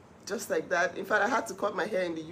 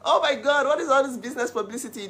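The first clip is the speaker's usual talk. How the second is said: excited exclaim; 6 semitones higher, 6 dB louder than usual. Retold or imitated. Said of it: "it's like".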